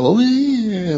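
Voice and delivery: playing a local answering in French; mock French reply. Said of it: "And they go".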